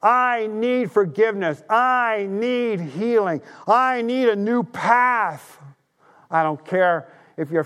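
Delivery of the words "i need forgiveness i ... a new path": model prayer. Shown as a demonstration. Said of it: "just simply say".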